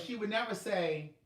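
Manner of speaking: ordinary talk, nothing marked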